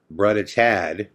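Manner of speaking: slow, drawn-out imitation of a girl calling; Katie's way of saying it. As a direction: lightly mimic how she says his name, slowly. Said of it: "that was her way of saying".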